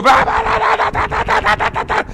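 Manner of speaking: mock shouting gibberish. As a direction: caricature everyone yelling at once.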